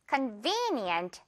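convenient slow and soft, V stressed; drawn-out drill word with stress on V; soft, slow drill read. Shown as a demonstration. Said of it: "V 부분에 강하게 여러분".

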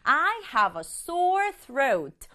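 very slow "I have a sore throat" with stress on "sore"; slow model sentence. Shown as a demonstration. Teacher modelling the sentence for students, very slow. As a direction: read it slowly, hitting "sore". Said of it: "그럼".

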